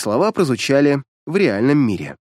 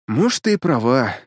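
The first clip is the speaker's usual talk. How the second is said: usual speaking voice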